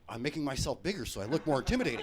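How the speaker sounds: put-on loud, earnest kid explaining herself; lightly voiced daughter.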